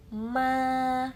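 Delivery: MA high and level, drawn out; "ma" long, with no change of pitch; level first tone. Shown as a demonstration. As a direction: stretch MA on one steady note. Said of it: "datar ya".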